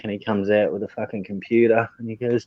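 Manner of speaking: ordinary talk, nothing marked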